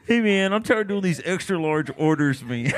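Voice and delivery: put-on fed-up pizza guy; Neil. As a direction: grumble as an overworked pizza man. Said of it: "real pizza guy that sounded exactly like Neil".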